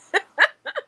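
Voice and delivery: loud, high burst of laughter; amused laugh. Real, not acted. Admitting she has no answer for strangers, amused laugh. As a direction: crack up loudly at your own predicament. Laughs at her own awkward spot.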